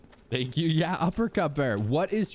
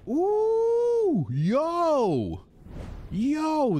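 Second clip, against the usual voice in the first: excited whoop; 4 dB louder, much slower than usual. Real, not acted. Excited reaction to fancy loot.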